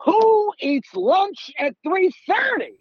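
high, slow, incredulous mom voice; mocking a scandalized mom; mom's shocked question. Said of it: "And my mom literally goes".

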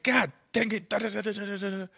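high, mock angry coach rant; coach yelling. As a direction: imitate a coach ranting at you. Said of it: "he just loves to yell".

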